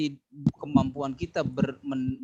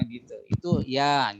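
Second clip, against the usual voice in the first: usual speaking voice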